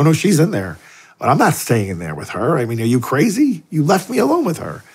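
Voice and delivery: rising indignation. Retold or imitated. Scolding priest who left him alone.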